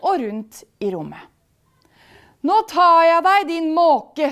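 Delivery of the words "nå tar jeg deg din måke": loud, high threatening spirit; yelling. A narrator's reading. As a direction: shriek it during a chase. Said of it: "skriker han".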